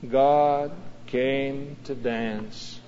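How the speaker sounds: slow, soft, reverent refrain; hushed refrain. Shown as a demonstration. Pastor leading the congregation in the refrain, hushed. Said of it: "say it".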